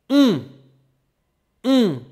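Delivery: on said high and loud through small rounded lips; teacher showing the nasal on. Shown as a demonstration. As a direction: push the lips forward and say on through the nose. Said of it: "my mouth is super small".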